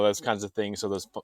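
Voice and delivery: ordinary talk, nothing marked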